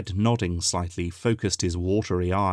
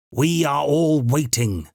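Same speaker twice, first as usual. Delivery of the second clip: loud, slow, every word stressed; emphatic; 7 semitones higher, 5 dB louder, slower than usual. A narrator's reading. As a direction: hammer each word home. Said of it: "she said emphatically".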